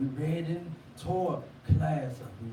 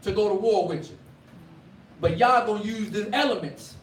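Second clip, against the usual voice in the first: preaching; 4 semitones higher than usual. Acted. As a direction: build the sermon to a ringing peak. Preacher building to full force.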